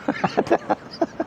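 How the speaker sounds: a sudden high airy burst of giggling; high breathy giggle. Real, not acted. Cracking up at Ella's face, high airy giggle.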